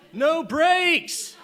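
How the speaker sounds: high, loud yell through a laugh; panicked shout. Retold or imitated. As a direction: scream it as the car runs away. Mom shouting in runaway car.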